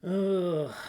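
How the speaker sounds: loud, drawn-out groan of fatigue; exasperated groan; weary ugh. Real, not acted. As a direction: let out a tired groan at the week. Weary sigh about stressful week.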